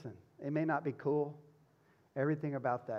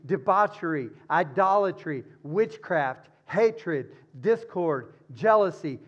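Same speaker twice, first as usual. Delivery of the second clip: louder emphatic reading of the list; scripture reading; 3 semitones higher, 10 dB louder than usual. Acted. Preacher reading verse nineteen aloud to the congregation. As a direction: proclaim each sin in turn.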